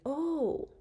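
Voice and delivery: high "Oh, oh" of sudden realization; dawning understanding. Shown as a demonstration. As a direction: sound like it finally clicks. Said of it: "you finally understand something".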